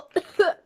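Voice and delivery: sharp loud cough. Real, not acted. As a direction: cough it out from the burn. Coughing after spicy bite.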